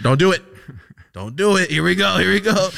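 high loud hype-up; excited. Acted. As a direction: pump yourself up loudly. Excited cheering himself on.

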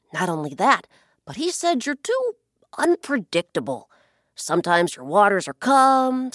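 a hint of a sly bird leaning in with a secret; sly blackbird. Blackbird baiting the ocean with gossip, loud and sly.

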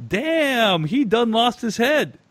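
high, mock audience heckler yelling, slow; mock shout from the audience. Reading a listener's story, the heckler's high mock shout. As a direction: play the loudmouth moviegoer in the row. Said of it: "a voice shouts out from the audience exclaiming".

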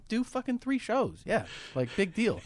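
mock nonchalant. Bragging, playing it cool.

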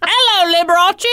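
high, loud, badly faked British accent; terrible British accent. Greeting [name] as a Brit, in a high, badly faked accent. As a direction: ham up a bad British greeting. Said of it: "That was terrible".

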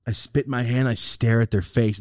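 usual speaking voice

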